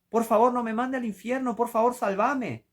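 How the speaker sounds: desperate sinner begging God for mercy; acted desperate plea. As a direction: plead with God not to be sent to hell.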